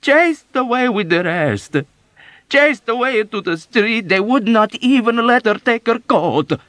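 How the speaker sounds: high, loud, slowed lament swelling up; growing wail. Acted. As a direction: let the wail build. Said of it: "her low wail growing louder".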